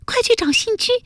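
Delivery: put-on high-pitched urgent girl's voice. Jialuo sends friends after Xinju, urgent and high. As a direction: press them to go, pitched very high. Girl urging friends to hurry.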